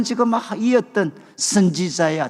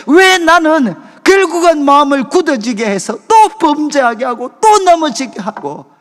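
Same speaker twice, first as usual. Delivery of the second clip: angry prayer; 5 semitones higher, 6 dB louder than usual. Retold or imitated. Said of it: "분노하면서".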